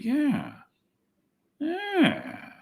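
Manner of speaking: usual speaking voice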